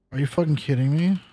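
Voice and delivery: usual speaking voice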